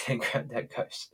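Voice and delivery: ordinary talk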